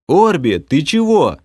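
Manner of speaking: high, loud bewildered call; puzzled shout. A narrator's reading. Mage shouts at crying elf mid-fight, bewildered. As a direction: yell in confusion at a crying friend. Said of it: "С недоумением крикнул я".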